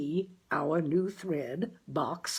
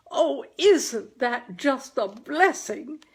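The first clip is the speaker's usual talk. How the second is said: high, loud, slow exclaiming of joy; mother bursts out in delight; jubilant; 7 semitones higher, 6 dB louder, slower than usual. A narrator's reading. Mother jubilantly hears the good news. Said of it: "exclaimed my mother jubilantly".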